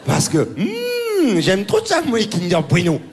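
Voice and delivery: overacted worry, rising high on the hope; hammy ad actor. Comedian imitating the tennis player, mock fretting. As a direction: ham up a fretting ad star.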